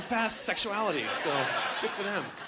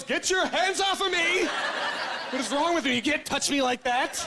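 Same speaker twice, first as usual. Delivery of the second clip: put-on offended girlfriend; 8 semitones higher than usual. Girlfriend pushing the boyfriend away, a hint of indignant yelling. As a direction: snap at him to back off. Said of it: "She goes".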